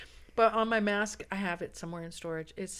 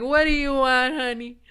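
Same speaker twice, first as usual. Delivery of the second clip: loud, higher-pitched call to a customer; hammed-up loud customer greeting; amused shop lady; 6 semitones higher, 11 dB louder than usual. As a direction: call it out big, imitating a tickled counter lady. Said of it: "just cracked up laughing. And she's like".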